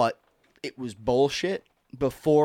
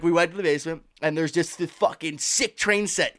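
ordinary talk, nothing marked

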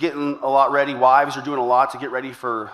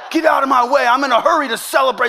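much higher, louder hammed-up road rage; mock angry driver; 10 semitones higher, 5 dB louder, faster than usual. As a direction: bark it like a furious shopper in traffic. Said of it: "honking at people, flipping people off".

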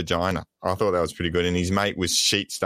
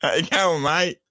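slightly higher, hammed-up broad Aussie voice, pretending to call in; laying on a mock Australian accent; put-on Australian accent; 9 semitones higher than usual. Pretending to ring in from Tassie in a mock Aussie accent. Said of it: "the way your accent disappears every now and then".

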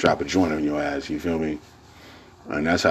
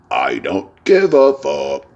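higher, slower, mocking defiance; defiant mock; 7 semitones higher, 4 dB louder, slower than usual. Real, not acted. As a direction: toss it off slowly with a sneer. Defiant line, then repeated calmly.

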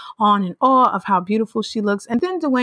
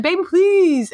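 "please" pitched high and pleading; high pleading imitation; 10 semitones higher, slower than usual. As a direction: beg "Baby, please" way up high and drawn. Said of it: "He just said".

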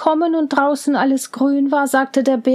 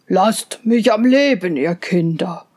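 put-on a bear begging the children in jest; playful bear; 5 semitones lower than usual. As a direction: lower bear voice, mock pleading.

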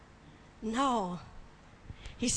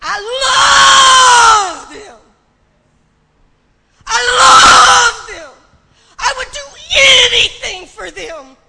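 impassioned shout; 11 semitones higher, 20 dB louder than usual. Retold or imitated. Preacher voicing God pleading for his people, crying out. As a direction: shout it with breaking fervor.

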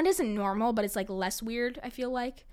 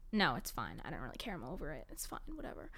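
fading away into a softer, dismissive mumble; mock indifference; 7 semitones lower, 12 dB quieter, faster than usual. To listeners, mock brushing off the sad story. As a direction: pretend not to care, trailing off.

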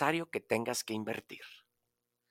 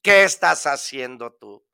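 loud, pressing question; loud second asking; loud challenge; 9 dB louder than usual. Acted. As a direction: demand it of the listener. Repeated question, soft then loud.

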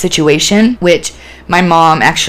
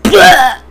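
high, loud burst of noise; explosive angry splutter; 11 semitones higher, 5 dB louder than usual. Retold or imitated. Showing how manic anger bursts out, explosive splutter. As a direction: imitate anger exploding in one syllable. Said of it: "anger, manic, just".

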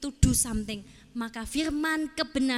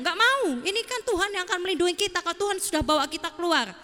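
higher-pitched mock sulk; sulky refusal; 5 semitones higher than usual. Joshua refuses the sword Moses hands him, sulky protest. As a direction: protest like a stubborn, sulking recruit. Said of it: "dia ngambek".

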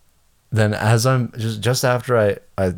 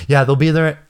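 high, hurried frantic reassurance; frantic phone call; 5 semitones higher, 4 dB louder than usual. Retold or imitated. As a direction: rush it like a frantic agent. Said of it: "frantically calling".

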